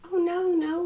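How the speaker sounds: high, loud burst of no no; sudden eager refusal. Acted. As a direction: blurt it out, alarmed. Princess suddenly refuses the idea.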